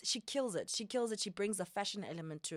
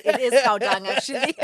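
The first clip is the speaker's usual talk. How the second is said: loud, higher, laughing agreement; real amused laughter; 5 semitones higher, 16 dB louder than usual. Real, not acted. Guest agreeing with host's cow dung joke, laughing. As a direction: crack up while agreeing.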